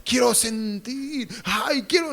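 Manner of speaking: mock yearning. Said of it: "Algunos piensan".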